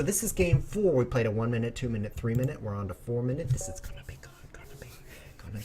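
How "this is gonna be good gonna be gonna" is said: hushed unvoiced whisper building suspense. Real, not acted. Streamer whispering to chat as the game begins. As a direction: whisper it like a secret before the game.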